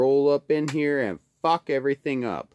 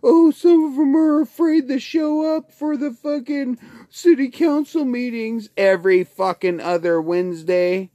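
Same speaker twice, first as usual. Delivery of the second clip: much higher, louder squealing mock whine; mocking whiny voice; 11 semitones higher, 7 dB louder than usual. Mimicking opponents afraid of council meetings, shrill mocking whine. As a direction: sneer like a whining critic. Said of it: "and then".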